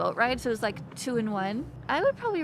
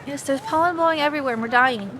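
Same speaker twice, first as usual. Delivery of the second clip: loud; 4 semitones higher, 9 dB louder than usual. Real, not acted. Loud complaint about pollen.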